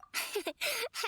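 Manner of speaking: light amused chuckle; gentle laugh at the stiff title. Acted. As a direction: laugh off the formality.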